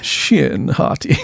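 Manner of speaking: slow, drawn-out dramatic name reveal; trailer announcer. Pretend. Podcaster mock-announcing a character name, trailer voice.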